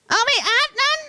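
high, put-on slurred "ไม่อาร์ตนั่น"; short-uvula speech. Child answers teacher, high slurred "ไม่อาร์ต". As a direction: garble "ไม่อาร์ต" for ไม่ชัด, dropping the first consonant. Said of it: "พอลิ้นไก่สั้น".

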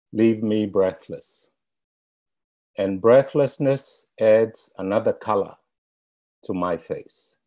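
measured, deliberate poetry reading with long pauses; poem recitation. Acted. Reserve poet closing the webinar with a spoken benediction, measured recital. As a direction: recite it like a blessing, letting each phrase land.

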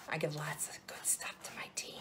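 conspiratorial whisper to the camera; hushed aside. Real, not acted. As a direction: let them in on a secret, voiceless. Secret aside to her viewers.

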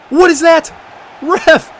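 high, loud protest at the ref; outraged appeal. Real, not acted. Gamer yelling at the ref after a foul, outraged. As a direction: demand the foul call. Fouled in the box.

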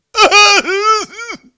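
a burst of loud high laughter. Real, not acted. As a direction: laugh out hard at yourself. Laughing at himself sobbing.

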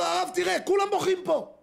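high, loud exclaiming soldier; retold soldier's outcry. Soldier at the Wall answering the rabbi, loud outcry. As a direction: cry out that everyone weeps.

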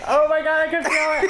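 very high laughing squeal; high, half-laughing panic; panicked giddy dread. Real, not acted. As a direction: squeal in nervous anticipation of pain. Dreads own painful pull, laughing.

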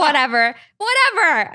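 rising, embarrassed brush-off; dismissive whatever. Real, not acted. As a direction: wave it away, flustered. Embarrassed brush-off after laugh.

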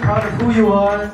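ordinary delivery

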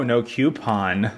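"coupon" said with a Q sound, KYOO-pon; "coupon" pronounced KYOO-pon, drawn out. Real, not acted. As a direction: say "coupon" starting like cue. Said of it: "There's no Q in".

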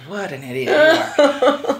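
slow, laughing mock scorn. Imagined agent thought, slow laughing scorn. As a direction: sneer it lightly, laughing. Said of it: "you could hear, like".

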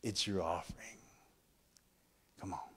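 soft unvoiced aside; whispered. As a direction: drop to a whisper. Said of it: "whispered aside after preaching".

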